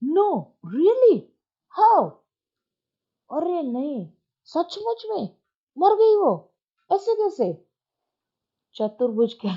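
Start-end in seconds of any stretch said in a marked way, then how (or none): 0.0-7.7 very high, drawn-out disbelief; mock astonishment. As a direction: ham up the surprise. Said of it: "पारण".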